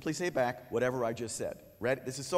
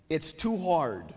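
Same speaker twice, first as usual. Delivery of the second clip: slow, higher, louder whine; whiny kid; 4 semitones higher, 4 dB louder, much slower than usual. Shown as a demonstration. Teacher playing a student who finds work too hard, slow whine. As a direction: drag it out like a frustrated kid. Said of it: "the kid's gonna say something else".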